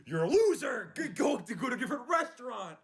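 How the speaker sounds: hammed-up shout telling him to leave; pretend shout. Imagined yelling back at customer.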